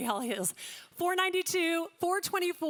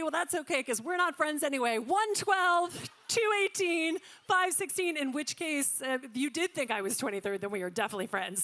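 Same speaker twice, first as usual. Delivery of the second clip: ordinary delivery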